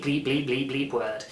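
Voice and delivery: normal talking voice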